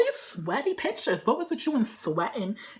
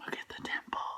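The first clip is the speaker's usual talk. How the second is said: adoring whisper; 10 dB quieter, much faster than usual. Real, not acted. Whispered adoration.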